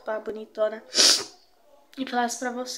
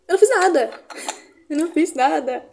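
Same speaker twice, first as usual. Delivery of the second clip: giddy protest; 3 semitones higher, 7 dB louder than usual. Real, not acted. Protesting sudden beauty.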